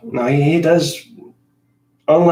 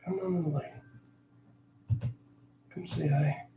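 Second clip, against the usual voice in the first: soft, slow coaxing to the cat; hushed sweet call to the cat; 3 semitones higher, 11 dB quieter, slower than usual. Real, not acted. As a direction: gently lure the cat over.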